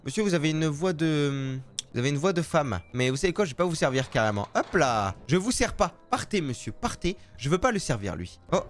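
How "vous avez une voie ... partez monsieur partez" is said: ordinary delivery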